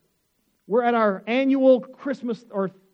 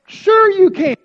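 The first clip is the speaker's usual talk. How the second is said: high, loud, eager yes; eager reply; 10 semitones higher, 13 dB louder, slower than usual. Retold or imitated. Pastor jumping up to accept the gift, loud and eager. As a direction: jump at the offer. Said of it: "I said".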